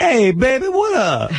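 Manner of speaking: very high, hammed-up street greeting. Shown as a demonstration. Host shows guest what to try, high caricature slang voice. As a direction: squeal out a mock slang hello.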